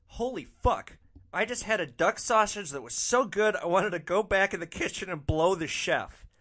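high-pitched, gushing amazement; excited raving. Retold or imitated. Passenger raves to driver about duck sausage, excited. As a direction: rave as if blown away.

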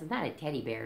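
plain reading voice

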